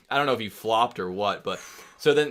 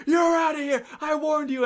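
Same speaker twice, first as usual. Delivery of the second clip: high, loud ejection shout; angry ref; 4 dB louder than usual. Retold or imitated. Ref throwing out a player, angry mock shout. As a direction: holler it like a fed-up umpire. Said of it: "He goes".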